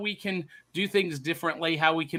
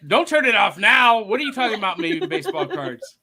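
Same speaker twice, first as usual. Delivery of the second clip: a louder mock husband perking up at his name; imitating husband; 6 semitones higher, 7 dB louder than usual. Co-host imitates the husband hearing the baseball card segment, mock startled. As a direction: ham up the husband waking at baseball cards. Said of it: "Is that how it went?".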